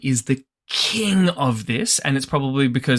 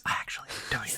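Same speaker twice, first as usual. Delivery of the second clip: whispering; 3 semitones lower, 14 dB quieter, much faster than usual. Real, not acted. Joking confession aside.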